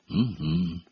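low, soft glum grunt of assent; a subdued, gloomy mm-hm. A narrator's reading. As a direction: mumble assent glumly. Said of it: "мрачно кивнул Гарри".

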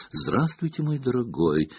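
hushed, honeyed greeting; cooing. A narrator's reading. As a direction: coo softly to a dear visitor. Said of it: "проворковала она".

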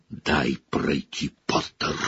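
teacher hissing at the student in his way. Acted. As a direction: hiss it through the teeth. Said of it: "зашипел Снегг".

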